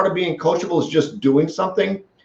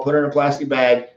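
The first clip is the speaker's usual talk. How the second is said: usual speaking voice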